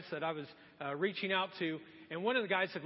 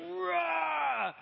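high, acted roar of effort; mock strongman roar; 9 semitones higher, 4 dB louder than usual. Samson bursting the new ropes, roaring. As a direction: roar while snapping imagined bonds.

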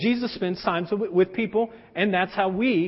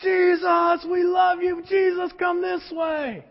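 crowd yelling; 8 semitones higher, slower than usual. Retold or imitated. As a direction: call out like a fan mobbing a rock star. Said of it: "they're yelling his name".

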